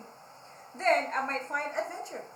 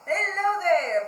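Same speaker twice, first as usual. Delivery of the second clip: greeting; 5 semitones higher, 10 dB louder than usual. Acted. Pig greeting Elephant.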